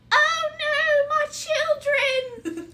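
very high, slow wailing cry; a tiny creature's hammy death cry; dying rabbit wail. Acted.

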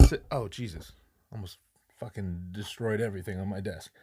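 normal talking voice

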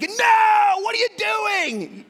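high, loud no at chewed shoes; angry shouted no; exasperated shout. Shown as a demonstration. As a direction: yell at the dog caught chewing. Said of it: "No has a tone".

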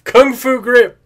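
high, loud ad announcer; toy commercial voice. Pretend. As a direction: punch it like a toy ad. Toy ad line.

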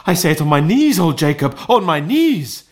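high, loud-edged thanksgiving; overwhelmed, glowing with good intentions; fluttered, fervent. A narrator's reading. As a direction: give thanks with rising fervour. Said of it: "his broken voice would scarcely answer to his call".

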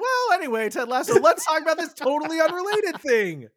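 hammed-up parody of their own segue; mocking ourselves. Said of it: "How many times have we said".

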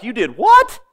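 high, loud shocked exclamation. Retold or imitated. Church folks shocked at the deacon, high loud disbelief. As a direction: throw it up in disbelief. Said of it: "they were all like".

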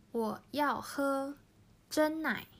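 我要喝 真奶 said slowly, each tone clear; slow Chinese model. Shown as a demonstration. Teacher modelling the reply, slow Chinese. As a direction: read 我要喝 真奶 slowly for learners. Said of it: "今まで教えた会話の中に".

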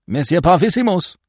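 high, loud call across the street. Acted. Binet calls André Louis back to the inn, loud call. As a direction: call him back before he goes.

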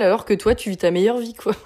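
ordinary talk

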